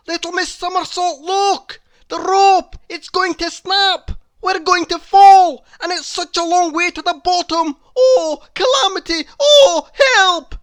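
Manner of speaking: high, loud, fast panic; panicked cry. A narrator's reading. Mister Brave crying out to Miss Somersault in loud high panic. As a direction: shout for help as the rope snaps. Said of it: "he cried out in panic".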